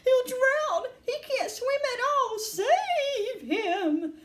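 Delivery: very high, frantic mother; shrieking, high-pitched alarm; panicked screaming. A narrator's reading. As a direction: scream for someone to rescue your child. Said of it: "screamed Missus Gloop".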